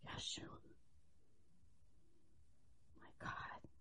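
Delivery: barely voiced, anguished whisper; hushed whisper of a woman praying; whispered prayer. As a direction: pray it silently, lips only. Acting Hannah's silent prayer.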